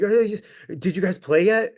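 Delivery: high-pitched imitation of an overexcited fan; crazy-sounding fan. Fan asking the band about their set, crazy-sounding. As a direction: ask it like a worked-up guy, voice up high. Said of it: "I know I sound crazy".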